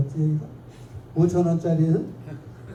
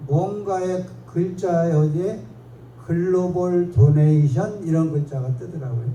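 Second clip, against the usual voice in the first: ordinary talk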